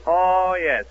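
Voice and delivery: ordinary talk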